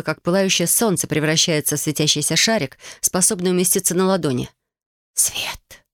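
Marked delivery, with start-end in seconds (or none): 5.1-5.8 soft, whispered spirit echo; voiceless, otherworldly whisper. A narrator's reading. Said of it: "повторил дух".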